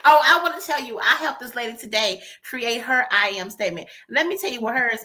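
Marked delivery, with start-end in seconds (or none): none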